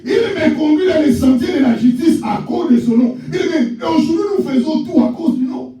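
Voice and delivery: high, shouted sermon over music; preaching at full force. Acted. Pastor exhorting beloved congregation, shouted high preaching. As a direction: proclaim to the whole church.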